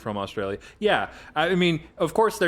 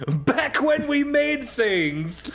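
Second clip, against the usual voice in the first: laughing; 12 semitones higher, much slower than usual. Real, not acted. Laughing mock nostalgia.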